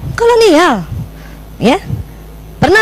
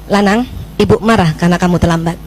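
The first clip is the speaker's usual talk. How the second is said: right calm reprimand; 7 semitones lower than usual. Shown as a demonstration. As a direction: own the anger calmly. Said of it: "itu yang keliru". (teguran tenang, lebih rendah dan lembut)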